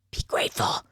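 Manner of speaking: breathy whispered rebuke; whispered be grateful; whispered scold. Real, not acted. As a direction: hiss it with a sigh. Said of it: "scolding game character".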